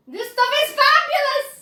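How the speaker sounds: high, loud gleeful cry; greedy delight. Acted. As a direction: relish the chocolate greedily. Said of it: "said Augustus".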